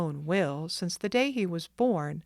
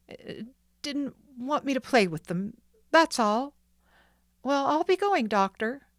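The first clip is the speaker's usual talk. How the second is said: put-on higher boy's voice, pushing out bravely; boy swallowing tears; 7 semitones higher, 4 dB louder than usual. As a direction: cover the hurt and hurry off. Boy covering a catch.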